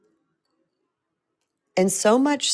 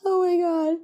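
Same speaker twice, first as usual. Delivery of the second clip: disbelief; 12 semitones higher than usual. Real, not acted. Laughing disbelief at brother's stunt.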